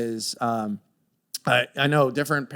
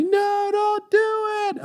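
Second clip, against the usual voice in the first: put-on high, pleading child's cry, far higher; kids begging; slower than usual. Kids beg dad not to trash their candy, high and pleading. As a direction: squeal it like a kid grabbing at you. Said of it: "they're grabbing me".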